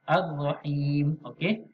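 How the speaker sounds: ordinary talk, nothing marked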